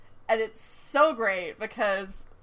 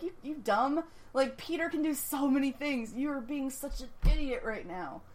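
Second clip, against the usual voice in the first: higher-pitched, softly exasperated scolding; exasperated anger; 5 semitones higher, 6 dB quieter than usual. Retold or imitated. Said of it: "I'm very angry at him".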